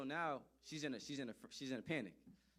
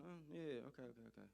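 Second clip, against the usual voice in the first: put-on mumbled kid reply; 6 dB quieter than usual. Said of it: "He said".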